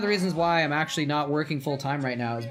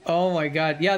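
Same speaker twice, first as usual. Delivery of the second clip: ordinary delivery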